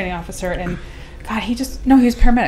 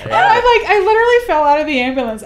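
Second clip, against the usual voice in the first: higher, real laughter through the words; laughing; 9 semitones higher, 5 dB louder than usual. Real, not acted. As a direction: laugh as you tell it. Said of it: "I laughed so hard".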